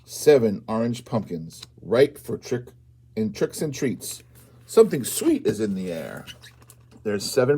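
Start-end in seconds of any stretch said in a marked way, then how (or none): none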